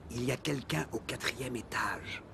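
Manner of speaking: hushed disguised whisper. Acted. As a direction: whisper it so nobody knows you. Anonymous call, disguised voice.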